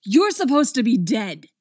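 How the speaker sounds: forceful, indignant and loud; loud accusation. A narrator's reading. Puppet to a man supposed to be dead, loudly accusing. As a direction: push each word out accusingly. Puppet confronts Elliot in narrated story.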